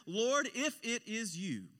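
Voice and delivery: slow, high, emphatic challenge; challenging call. Acted. Peter demanding proof from Jesus on the water, challenging.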